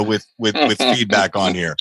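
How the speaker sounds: normal talking voice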